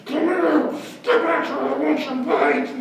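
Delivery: hammed-up scary yell. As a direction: roar after him to scare. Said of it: "Trying to be as scary as I could".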